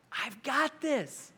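slow, hushed reassurance; steady God calming Moses; God reassuring. Retold or imitated. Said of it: "God has to tell Moses".

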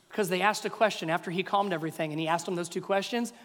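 ordinary talk, nothing marked

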